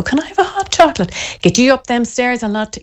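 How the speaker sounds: high, fast mocking kid voice; mocking little brother. Impulsive brother mocks mum coddling his sibling, high fast taunt. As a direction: mimic a jealous little brother teasing. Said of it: "And he'll go".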